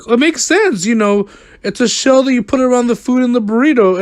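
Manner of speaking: high, loud mock-innocent voice; mocking friend. Imitating friend defending her silly word, high mocking voice.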